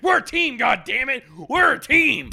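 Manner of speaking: high, loud, indignant yell; shouted imitation of a worked-up friend; mock outburst. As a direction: yell it in hammed-up outrage. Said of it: "and he's like".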